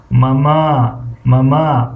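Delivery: ماما with the final ma stretched; teacher repeating ماما; second syllable long. Shown as a demonstration. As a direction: draw out the end of ماما.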